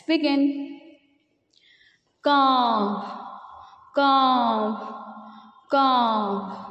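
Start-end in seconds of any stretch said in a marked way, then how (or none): 2.2-6.6 cawing bird call, drawn out; crow's caw in a children's story. Shown as a demonstration. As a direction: caw like a thirsty crow. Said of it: "I will narrate this story in the form of dialogues".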